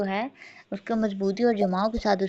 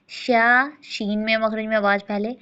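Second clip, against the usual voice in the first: ordinary delivery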